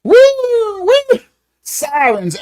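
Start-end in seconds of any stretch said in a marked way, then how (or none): 0.0-1.2 very high siren wail, woo woo; imitated police siren. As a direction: mimic a police car siren with your voice. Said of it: "how they got that siren on the police car".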